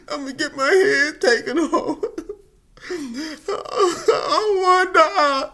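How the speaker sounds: high, slow, laughing wail; hammed-up crying about dying; mock sobbing. Remembering his fear of dying, mock sobbing.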